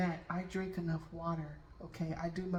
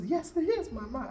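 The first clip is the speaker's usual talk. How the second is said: higher, louder mock insistence; retold insistence; 7 semitones higher, 6 dB louder than usual. Son assuring a doubting stranger, emphatic insistence.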